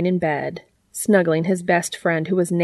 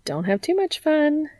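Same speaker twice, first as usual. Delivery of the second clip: high, playful parting tease; teasing smirk; 10 semitones higher than usual. A narrator's reading. Alex teases Dean on leaving the room, smirking. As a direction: say it with an audible smirk. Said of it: "she added with a smirk".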